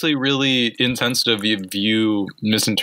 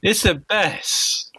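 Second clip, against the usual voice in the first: slow, higher drawn-out Italian boast; mock Italian accent; 4 semitones higher, much slower than usual. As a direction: stretch it out like a cartoon Italian. Italian bit continues.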